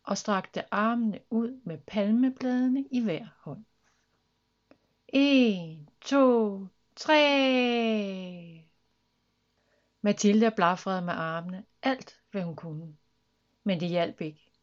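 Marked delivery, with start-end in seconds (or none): 5.1-8.6 big, raised-pitch count for takeoff; countdown. A narrator's reading. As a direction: count loudly, last number drawn long. Counting before the jump.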